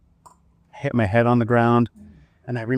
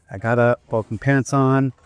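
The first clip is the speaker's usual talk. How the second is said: normal talking voice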